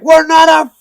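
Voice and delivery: very high, very loud, slow shout; furious shout. Retold or imitated. Answering the friends' cheer from the wall, a furious shout. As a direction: scream it back like a raging child. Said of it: "acting like a child".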